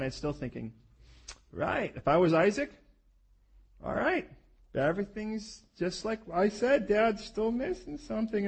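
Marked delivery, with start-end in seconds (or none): 1.5-8.5 put-on a lightly raised, slow young Isaac musing; voicing Isaac. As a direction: think it through as Isaac, a bit higher. Preacher voicing Isaac's thoughts.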